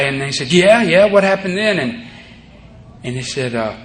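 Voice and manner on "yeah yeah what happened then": quick, high, pushy asking; eager questions. Retold or imitated. Listeners pushing the speaker to keep going, eager. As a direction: press him eagerly for the rest.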